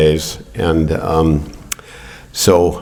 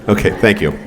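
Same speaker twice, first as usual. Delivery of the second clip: usual speaking voice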